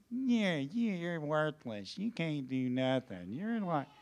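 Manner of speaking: soft, slow sneering voice of doubt; a quiet voice whispering you're no good; lightly voiced hissing accuser; inner accusing voice. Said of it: "this voice that says".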